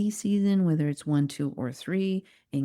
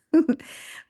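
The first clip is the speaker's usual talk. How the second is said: burst of laughter; 12 semitones higher, 5 dB louder than usual. Real, not acted. Laughing at her staged photos.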